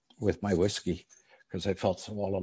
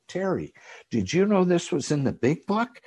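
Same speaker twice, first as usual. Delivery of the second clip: much higher, eager rush of amazement; excited discovery; 9 semitones higher, 3 dB louder than usual. Retold or imitated. Newcomer telling his sponsor Terry about the big book, high-pitched excited. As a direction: blurt it like you just found treasure.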